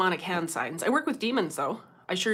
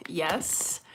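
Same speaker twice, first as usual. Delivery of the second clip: ordinary delivery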